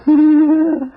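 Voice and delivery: high, loud gloating laugh; a sinister chuckle of triumph; a scheming doctor's gloating laugh; villainous laugh. Acted. Villain laughs at his trap.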